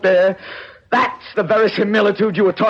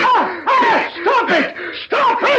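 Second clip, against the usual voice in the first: high frantic cries of stop it; desperate to save the exhibit; panicked shout; slower than usual. Acted. Gallinari stops the destruction.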